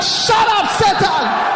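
loud high shout; preacher urging the church to shout. Acted. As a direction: yell the rebuke with full force. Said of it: "You must begin to shout".

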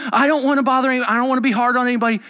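high, rushed excuse; acted protest. Acted excuse of a reluctant person.

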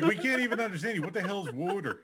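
high, laughing Philly-accent impression; teasing Philly accent; mock Philly locals. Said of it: "They're like".

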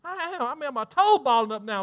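ordinary delivery

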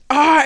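short loud high cry; imitated scream. Friends screaming in the background, loud high cry. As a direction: yelp in acted pain. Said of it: "his friends are now screaming".

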